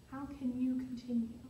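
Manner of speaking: ordinary talk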